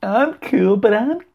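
mock falsetto, very high and held; put-on high voice. Host joking about trying to be cool, silly falsetto.

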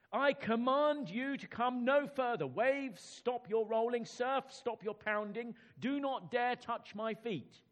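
slow, high, loud command to the sea; royal command. Acted. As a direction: order the waves to halt. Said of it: "cried Canute".

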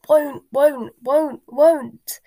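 high, loud elf tantrum; stubborn creature's repeated wail; croaking house elf. A narrator's reading. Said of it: "Creature's continued croaks of".

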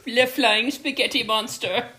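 mock French accent. Jokey mock-French take.

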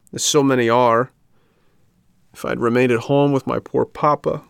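high, plaintive puppet lament; tearful regret, voiced in earnest; crying. A narrator's reading. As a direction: wail with self-reproach, pitch lifted.

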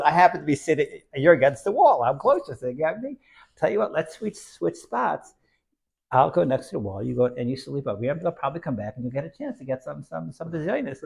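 high, loud playful suggestion; playful offer. Retold or imitated. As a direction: offer the trade cheerfully. Zusha offering to switch spots.

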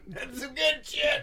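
slow, high, breathy stoner drawl. Pretend. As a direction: savor it like a stoner. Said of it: "They were on the corner going".

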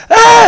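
short high wail; quick mock tantrum cry; imitated child scream. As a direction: mimic a toddler's squeal.

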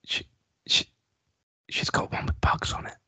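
whispered conspiratorial aside; whispering. Real, not acted. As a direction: hush it like a secret. Secretive aside about mother.

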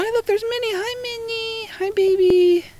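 high pet-greeting voice. Real, not acted. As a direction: coo at the pet.

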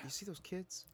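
hushed, breathy whisper. Acted. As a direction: keep it down to a whisper. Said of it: "We don't have to whisper this".

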